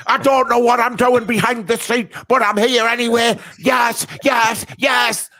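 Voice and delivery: high, loud shouted mock Manc accent; Goldbridge impression. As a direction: yell it like a giddy fan, in a Manc accent. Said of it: "My impression of him is this one".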